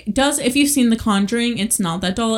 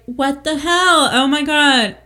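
drawn-out, higher-pitched disbelief; mock shock; 5 semitones higher, 4 dB louder, slower than usual. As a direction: act out stunned alarm, stretching the words. Acting out roommates finding the doll.

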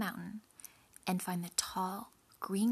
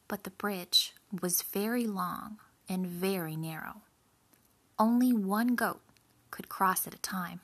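plain reading voice

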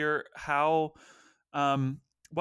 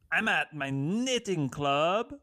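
ordinary talk

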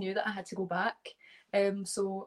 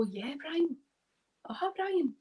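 higher-pitched pretend listening; humouring Brian; 6 semitones higher than usual. Scottish guest shows how to bluff through Brian's chat, humouring him. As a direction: nod along as if following every word. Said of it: "or just go".

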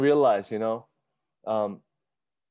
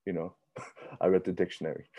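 mock wistful murmur; 7 semitones lower, 8 dB quieter than usual. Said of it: "you're like".

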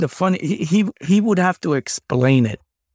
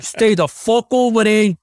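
put-on New York accent; 8 semitones higher than usual. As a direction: bark it like a pushy New Yorker. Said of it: "he talks like a New York accent".

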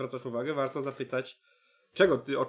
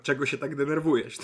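mock irritated reproach; 2 semitones higher, 7 dB louder than usual. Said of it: "a nie na przykład".

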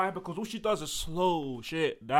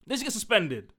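ordinary delivery

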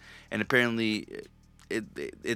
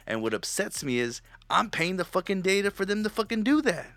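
higher, ranting, pitch climbing; fired-up complaint, higher and faster at the end; worked-up rant; 8 semitones higher, 3 dB louder than usual. Real, not acted. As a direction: vent it, getting more wound up. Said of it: "I just got excited".

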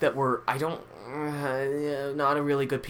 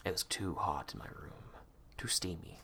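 soft low whisper; 7 semitones lower, 13 dB quieter than usual. Real, not acted. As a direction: whisper it close to the mic. Whisper aside.

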